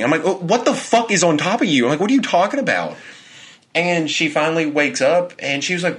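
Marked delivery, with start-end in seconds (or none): 0.2-1.8 high, stammered retold disbelief; bewildered alarm. Fiancé questioning half-asleep fiancee about her dream, bewildered. 2.0-2.9 high-pitched incredulous question; bewildered retelling. Retold or imitated. Fiancé questioning his half-awake fiancee, bewildered. Bewildered reply to her dream.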